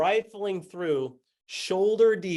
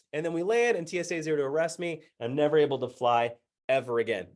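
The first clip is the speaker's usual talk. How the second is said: usual speaking voice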